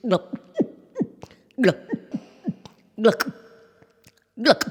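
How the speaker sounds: soft throaty gulps; gulping sounds. Acted. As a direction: swallow noisily, one by one. Snake swallowing the chicks.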